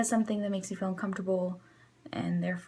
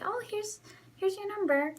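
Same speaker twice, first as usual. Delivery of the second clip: bright service voice; 11 semitones higher than usual. Retold or imitated. Cheerful drive-thru voice.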